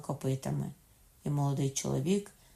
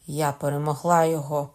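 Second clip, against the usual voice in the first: loud, mildly triumphant declaration; exclaiming; 9 dB louder than usual. A narrator's reading. Katherine claims victory, loud exclamation. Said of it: "скрикнула Катерина".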